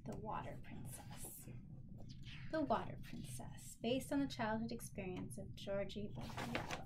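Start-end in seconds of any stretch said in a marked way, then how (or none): 0.1-3.8 soft unvoiced whisper, savoring the title. Real, not acted. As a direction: whisper it like opening a secret book.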